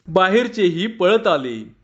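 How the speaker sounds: ordinary delivery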